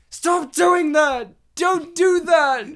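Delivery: loud, slow yelling at the screen. Retold or imitated. Yelling at the TV so girlfriend can guess. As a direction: shout it out, drawn out. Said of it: "I just kept yelling".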